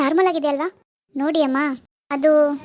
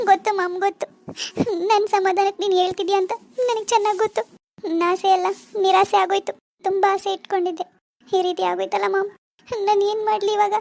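put-on higher, tearful despairing young wife; tearful daughter; 4 semitones higher than usual. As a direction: complain to mom through tears. Daughter grieves to mother. (ಅಳುವ ಮಗಳು)

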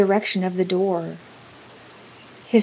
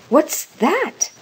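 high-pitched, alarmed question; startled cry; 9 semitones higher, 4 dB louder than usual. A narrator's reading. Old woman starting up at a knock, high startled cry. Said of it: "cried the old woman".

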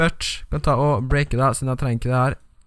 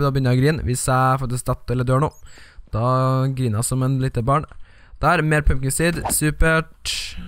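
normal talking voice

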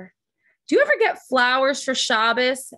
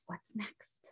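hushed secret whisper; 5 semitones lower, 19 dB quieter than usual. Acted. Teasing the next page.

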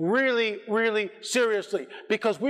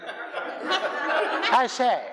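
mock crisp English exclamation, pitched up; crisp English accent; 4 semitones higher than usual. Preacher mocking a chimpanzee who learned to talk, crisp English accent. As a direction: put on a hammed-up English accent. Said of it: "speaking in a crisp English accent".

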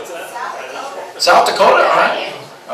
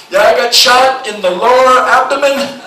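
slow, higher, emphatic answer; exasperated caller; 5 semitones higher, slower than usual. Acted. Wounded caller answers the operator, acted exasperation. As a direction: spell it out in frustration.

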